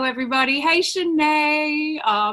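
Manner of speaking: ordinary talk, nothing marked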